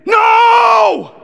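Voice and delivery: very loud, high-pitched screamed protest; toddler's scream. Retold or imitated. Two-year-old protesting dad eating all the candy, full scream. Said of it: "Like, he screamed".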